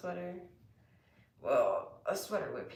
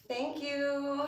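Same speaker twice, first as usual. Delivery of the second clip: ordinary delivery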